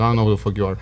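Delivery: low-pitched, blunt brush-off; indifferent retort. Retold or imitated. Patient repeating his reply to a stranger, indifferent. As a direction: repeat the dismissal low and offhand. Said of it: "but that's how I said it".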